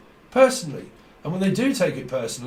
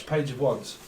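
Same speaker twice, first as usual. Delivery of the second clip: normal talking voice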